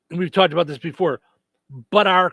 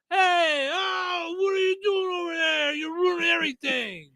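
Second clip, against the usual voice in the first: high mock yell at the bear; hammed-up panicked policeman; frantic cop; 12 semitones higher, 3 dB quieter than usual. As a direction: shriek at the bear like a flustered officer. Mocking the cop yelling at bear.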